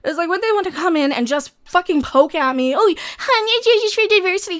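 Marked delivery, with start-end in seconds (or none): 2.8-4.6 high, condescending put-on voice; mocking imitation. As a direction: sneeringly mimic a patronizing troll. Mimics trolls poking at them.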